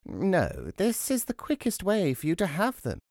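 high voice of a toothless crone; old crone. A narrator's reading. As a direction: answer firmly as the old crone. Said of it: "said the old woman with nose and chin touching".

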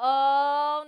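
loud, long drawn-out "oh"; mock dread. Counselor caught up at two a.m., mock dread. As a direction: stretch it out in comic dread.

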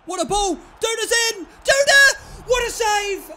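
shouting out high and loud; excited shout. Real, not acted. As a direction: yell it like a near goal. Goal chance, yelling at screen.

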